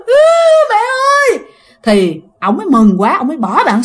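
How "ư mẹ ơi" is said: put-on high wailing child's cry. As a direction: shriek for mom through tears. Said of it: "khóc lên, ré lên". (trẻ khóc ré gọi mẹ)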